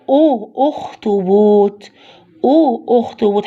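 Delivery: drawn-out "oo", then "أخطبوط" starting with u; teacher sounding out the u in "أخطبوط"; first sound isolated. Shown as a demonstration. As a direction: hold the opening "أو" slowly before "أخطبوط". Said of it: "يبقى هو عايز الأو".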